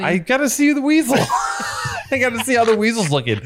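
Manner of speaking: very high-pitched giddy squeal, breaking into laughter; giddy excitement. Retold or imitated. Telling friends about spotting the weasel in the sauna, giddy squeal. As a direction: squeal it, cracking up midway.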